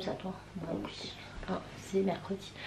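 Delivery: breathy whisper, barely voiced. Real, not acted. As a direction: whisper it aside. Aside to daughter.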